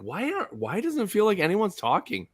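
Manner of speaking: high-pitched mock confusion; acted-out puzzlement. Player retelling a silent headset problem, acted-out puzzlement. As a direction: raise the voice in puzzled self-quotation. Acting out own puzzled thought.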